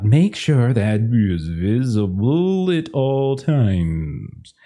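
slow, stern clerk reminding a visitor; receptionist voice. Acted. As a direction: draw each word out like a strict clerk.

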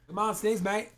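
light Aussie twang; Australian accent. Retold or imitated. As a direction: tease in Aussie voice. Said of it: "Australian accents are so fun".

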